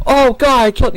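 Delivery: sudden high, loud burst of imitated panic; mock panic. Mocking the driver's panic right after the crash, high and loud. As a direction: blurt it like a driver who just hit someone. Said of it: "you panic".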